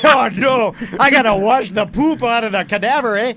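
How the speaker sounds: put-on comic Canadian accent, dismayed; horrible Canadian accent. Mountie having to clean up the cadaver, in a mock Canadian accent. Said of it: "that's a horrible Canadian accent".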